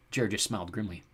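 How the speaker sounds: ordinary delivery, nothing marked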